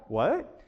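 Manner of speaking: "what" as a question, lifted at the end; questioning prompt. Shown as a demonstration. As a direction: ask "what" with a rising tune, inviting explanation. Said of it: "what's an accent?".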